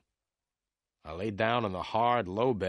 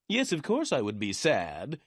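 higher-pitched, mildly indignant reply; angry; 5 semitones higher, 3 dB louder than usual. A narrator's reading. Lawyer Meyer answers whether he'd be sad, indignant. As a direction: snap back, offended by the question. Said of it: "Mister Meyer replied angrily".

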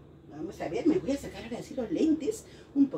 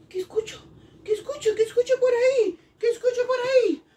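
high, quick, rising in pitch and volume; mock alarm; 8 semitones higher, 3 dB louder, faster than usual. Teacher pretends to hear an intruder, playfully alarmed. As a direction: repeat it more urgently, pretending surprise.

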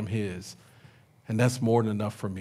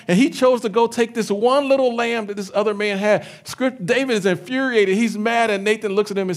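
louder, higher, building outrage at the rich man; rising indignant preaching; 7 semitones higher, 5 dB louder than usual. Acted. Pastor retelling Nathan's story, building into indignant preaching. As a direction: preach the injustice with mounting heat.

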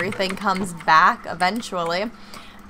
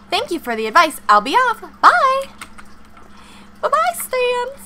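cheery high goodbye; 13 semitones higher, 6 dB louder than usual. Acted.

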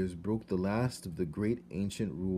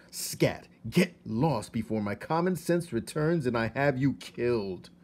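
a furious king threatening; king's cold threat, louder; angry king; 3 semitones higher, 6 dB louder than usual. A narrator's reading. Angry king ordering mouse away.

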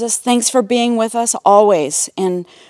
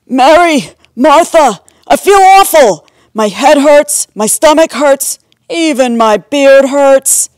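loud, higher-pitched groan of self-pity; complaining; 4 semitones higher, 6 dB louder than usual. A narrator's reading. Sick brother to his sisters, complaining loudly. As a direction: moan about every ache, loudly. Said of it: "Lazarus complained to his sisters".

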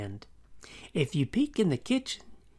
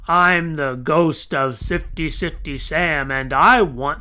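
loud ghost roar, building to a slow bellowed demand; booming ghost demanding more; mighty roar; 9 dB louder than usual. Acted. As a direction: roar with all his might. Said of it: "roared with all his might".

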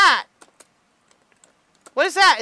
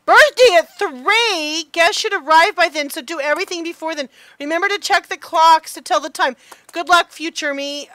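reading a note aloud; 4 semitones higher, 8 dB louder than usual. Acted. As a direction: read the note like a message to yourself. Reads note from past self.

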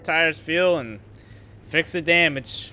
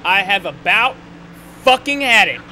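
higher and louder, boiling over; angry outburst; 4 semitones higher, 7 dB louder than usual. Real, not acted. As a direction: let the anger break out on had. Driver fed up after crash.

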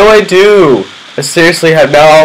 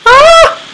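sharp high-pitched scream; startled shriek. Real, not acted. Gamer caught off guard by an enemy, sharp high shriek. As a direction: shriek at a sudden threat.

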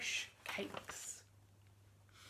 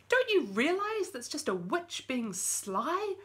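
cried out; 7 semitones higher, 5 dB louder than usual. Acted. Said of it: "cried David".

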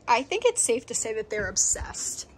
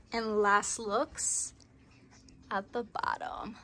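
hushed; 3 semitones lower, slower than usual. Pretend. Dramatic reveal before transformation.

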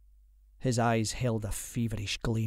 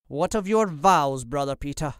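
raised voice with a sneer; mildly mocking, higher at the start; 4 semitones higher, 6 dB louder than usual. A narrator's reading. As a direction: taunt him with his own vows. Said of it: "Lord Percy sneered".

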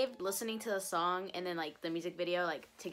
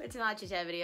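usual speaking voice

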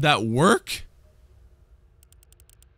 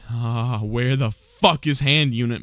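usual speaking voice

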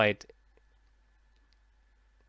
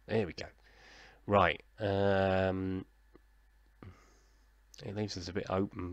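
ordinary delivery

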